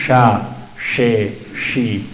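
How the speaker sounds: sha, she, she with the tongue pulled back, not Spanish ch; correct sh demo. Shown as a demonstration. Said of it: "sino es".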